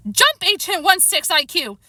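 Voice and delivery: high, slow drawn-out shout; high-pitched battle cry. A narrator's reading. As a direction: shout a command mid-leap. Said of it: "Lyle shouted".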